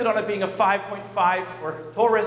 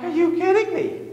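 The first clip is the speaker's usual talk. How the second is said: can't believe what he's hearing; incredulous outburst; 11 semitones higher than usual. Retold or imitated. Nervous rafter to the reckless guide, incredulous high outburst.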